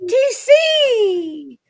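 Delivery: high excited shout; loud call. Real, not acted. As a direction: call out his name. Calling out to TC arriving.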